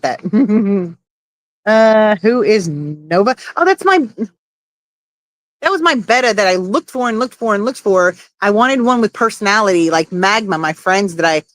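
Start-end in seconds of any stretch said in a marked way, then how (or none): none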